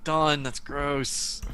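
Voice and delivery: disgusted. Acted. Disgusted scolding of horse.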